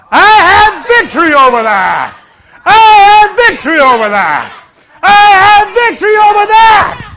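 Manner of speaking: full-force, high rallying shout; authoritative shout. Shown as a demonstration. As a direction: shout it like a policeman stopping a crowd. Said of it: "speak like a spiritual policeman".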